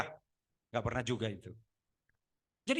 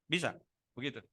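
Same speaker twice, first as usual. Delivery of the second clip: ordinary talk, nothing marked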